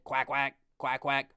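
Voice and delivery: quick, low-key mock quacking; Bill Walton. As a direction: imitate a commentator quacking on repeat. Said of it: "He said".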